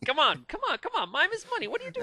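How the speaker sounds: hammed-up shrill pleading; silly high voice. Mock [name] shouting.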